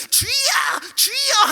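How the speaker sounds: high-pitched imitated prayer cries; mock crying out. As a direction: cry out like fervent worshippers, exaggerated. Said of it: "하는 소리가 들리는데". (높은 소리로 부르짖는 기도 흉내)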